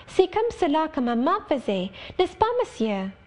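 put-on a child fishing for praise, "comme cela" high and sing-song; Adele asking sweetly. Adele asking Rochester if this is how mama did it, coaxing and high. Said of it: "she added".

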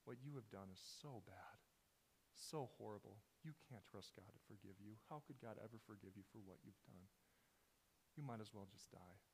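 hushed, heavy, murmuring despair into his ear; low soft voice of temptation; Satan whispering despair. Retold or imitated. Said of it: "you can hear Satan say".

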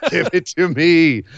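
said through real laughter, slow; laughing. Real, not acted. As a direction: laugh it out, loving every second. Hyped by the wrestling.

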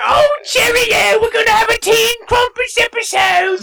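put-on British accent. Cohost cheering the episode idea in a mock British accent. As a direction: shout it like an excited posh Brit. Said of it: "speaking in British accents".

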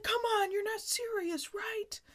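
high, pleading whine; Joey begging the friends, high pleading whine; pleading disbelief. Retold or imitated. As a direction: beg them to say it isn't true.

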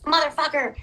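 mock furious curse; frustrated curse. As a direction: spit it out at the symptom. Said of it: "the symptom comes and I'm like".

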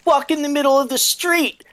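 put-on very high, loud taunting kid; child voice. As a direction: squeal it like a mean child. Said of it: "said one of the kids".

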